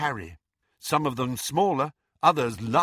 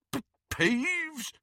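high, stuttering query; bewildered stammer. A narrator's reading. Caretaker hears an unexpected name, stammering in confusion. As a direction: stumble on the first sound, baffled. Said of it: "stammered Filch".

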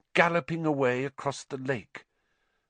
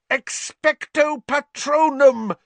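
high, slow, drawn-out yell; 9 dB louder, slower than usual. A narrator's reading. Harry alone against the Dementors, yelled. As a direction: stretch the spell into a loud cry. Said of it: "Harry yelled".

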